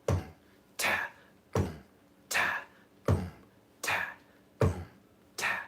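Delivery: low, soft "boom" and crisp "ta" alternating; vocal drum beat. Shown as a demonstration. Teacher showing the groove as drums, beatboxed beat. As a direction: mouth a simple kick and snare pattern. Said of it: "If this was a drum beat".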